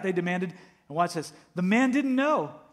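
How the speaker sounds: loud, high, urgent sermon delivery; full-force preaching. Acted. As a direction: preach it out to the whole room. Pastor preaching to congregation.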